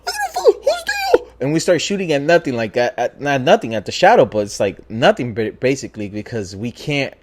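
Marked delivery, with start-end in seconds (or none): 0.1-1.3 very high falsetto mock scream; girlish scared voice. Imitating his scared boy facing shadows, girlish falsetto shriek. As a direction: squeal in panic, imitating a terrified friend. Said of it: "That's how scared he got".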